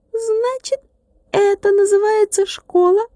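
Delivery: high ringing tiny voice, puzzled; a little glass drummer, thin and high; tiny glass man. A narrator's reading. (крошечный стеклянный человечек, тонкий звенящий голос)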